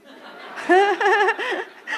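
high, loud burst of laughter; real big laugh. Real, not acted. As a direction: laugh out at the punchline. Loud laugh at sponsor's reply.

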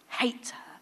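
soft, bitter whisper; quiet venom; whispered hatred. Retold or imitated. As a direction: hiss it under breath. Retold hatred, hushed.

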